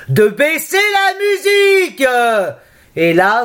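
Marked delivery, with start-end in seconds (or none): 0.1-2.1 long high yell; yelled demo. Shown as a demonstration. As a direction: yell it up three floors. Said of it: "vous devrez hurler".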